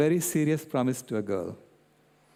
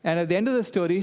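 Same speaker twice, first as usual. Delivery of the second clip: ordinary delivery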